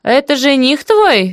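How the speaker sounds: high, slow, suspicious question; prying auntie, pitched high and drawn out; jealous. A narrator's reading. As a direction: ask with a touch of jealousy. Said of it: "ревниво спросила тётка".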